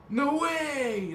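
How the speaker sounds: excited high surfer dude exclamation; Bill and Ted surfer. Retold or imitated. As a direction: shout it like a stoked surfer dude. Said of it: "Like Bill and Ted?".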